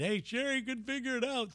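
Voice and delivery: a hint of a smug neighbor voice; mock onlooker. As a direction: imitate a gossiping onlooker. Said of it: "that's the last thing I need".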